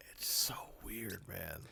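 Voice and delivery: hushed whisper. Real, not acted. Drops to a whisper.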